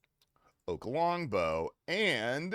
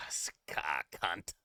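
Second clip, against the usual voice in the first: whispered Aussie slang; 10 semitones lower, 7 dB quieter than usual. Acted. Streamer trying Australian slang, whispered Australian accent.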